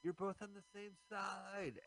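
slow, high mock pleading; high-pitched girls pleading. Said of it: "run up while they're fighting and say".

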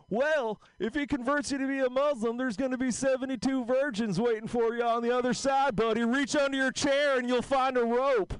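put-on sly old vaudevillian punchline delivery; George Burns impression. [name] telling Jeffrey what waits on the other side, as old George Burns. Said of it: "sounds like George Burns".

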